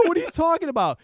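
high-pitched retold disbelief; incredulous. Customer answers the mechanic's accusation, incredulous. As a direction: throw it back in baffled protest. Retold baffled reply in quarrel.

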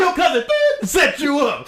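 high-pitched gleeful reveal; Nephew Tommy. Retold or imitated. Prank caller revealing who set them up, high put-on voice. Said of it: "in the same voice".

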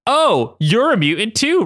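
high-pitched, drawn-out realisation; knowing. Acted. Ryan learns Madeline is a mutant, knowing. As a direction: let recognition lift the pitch. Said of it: "Ryan said knowingly".

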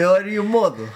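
put-on laughing Mark Wahlberg-style Boston accent. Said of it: "Here's my Boston accent".

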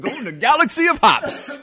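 laughing through it; giggling the words. Real, not acted.

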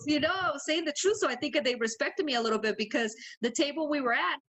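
normal talking voice

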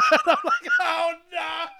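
words squeezed out through crying laughter; can barely speak for laughing; high, breaking laugh-cry; laughing hysterically. Real, not acted. Laughing fit while retelling.

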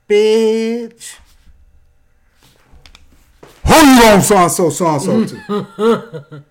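high, loud, sassy challenge, drawn out at the end; confrontational drag voice. Retold or imitated. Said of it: "looked at her and said".

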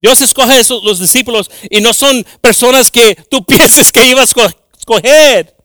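loud, high preaching, pushing each line out; preaching at full force. Acted. Pastor preaching the apostles' doubts to the church, at full force. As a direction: preach it to the congregation.

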